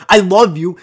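loud, barked declaration of love; high, forceful mock-angry shout; aggressive. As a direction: yell affection like a threat. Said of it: "why are you getting so aggressive".

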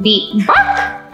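ordinary talk

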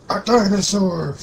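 high and loud, falling on dinosaur. Pretend. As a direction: squeal out the word dinosaur. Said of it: "I think I'm turning into".

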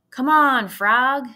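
put-on loud boyish yell calling the frog. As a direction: holler to the frog across the yard. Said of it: "yelled Avery".

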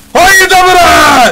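slow, drawn-out mock battle cry; Ottoman war cry. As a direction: bellow it like a charging janissary. Conquering Constantinople in game. (ağır ağır, yüksek sesle savaş narası)

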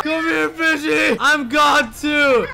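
high, drawn-out shouting; hammed-up high scream; mock furious yell. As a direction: holler after them in a chase. Mock rage, chasing friend.

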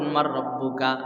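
lightly voiced, low and soft question from the angel; angel's question. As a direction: ask it low and softly, a hint of the grave angel.

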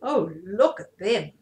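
ordinary delivery, nothing marked

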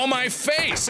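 high, sudden protest; pained outcry. Real, not acted. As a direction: cry out at a hit in a game. Hit in the game.

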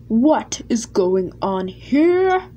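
slow, booming demand, drawn out; loud, deep and grim. A narrator's reading. Sir Topham Hatt interrupting the quarrel, loud and grim. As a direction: halt the quarrel with one grim question. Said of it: "a very loud, a very deep, and a very grim".

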